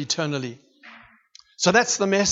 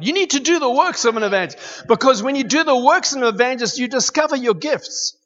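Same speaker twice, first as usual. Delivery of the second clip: preaching at full force; 7 semitones higher than usual. Acted. Preacher exhorts the church about the word's power, fervently. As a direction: drive the point home to the congregation.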